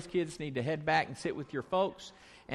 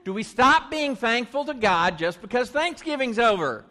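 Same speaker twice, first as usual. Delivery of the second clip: louder and higher, stop punched; louder, more energy; 5 semitones higher, 5 dB louder than usual. Shown as a demonstration. Pastor urging children to answer with more energy. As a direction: rouse the kids into answering. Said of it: "Okay, do better than that".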